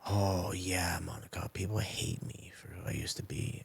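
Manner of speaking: whispering it, all breath; a hushed confession to the healer; whispered confession. Retold or imitated. Confessing to Monica the healer that people hate him, whispered.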